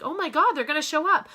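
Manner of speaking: thrilled cry about the ten thousand dollar paychecks; excited Oh my God. Retold or imitated. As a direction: burst out with joy. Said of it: "And I'm like".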